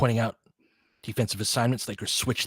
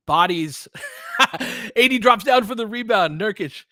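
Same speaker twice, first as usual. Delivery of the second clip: laughing play-by-play; 10 semitones higher, 5 dB louder than usual. Real, not acted. Laughing at a scramble under the rim.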